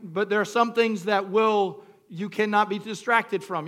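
ordinary delivery

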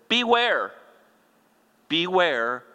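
high, loud warning read from scripture; stern warning. Acted. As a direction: sound the warning, drawing out each word. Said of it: "then he gives a warning".

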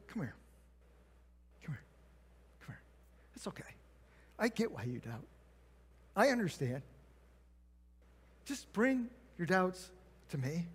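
soft, hushed reassurance to a doubter; Jesus quietly comforting a doubting believer; tender Jesus. Retold or imitated. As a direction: gently draw someone close and calm them.

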